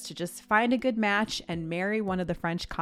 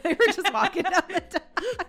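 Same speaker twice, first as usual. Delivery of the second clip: high and laughing through words; 11 semitones higher, 4 dB louder than usual. Real, not acted. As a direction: giggle while saying it. Both laughing at plank joke.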